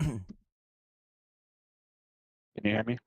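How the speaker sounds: distorted, robotic mic sound. Real, not acted. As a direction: talk through a glitching mic. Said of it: "you still sound like a robot".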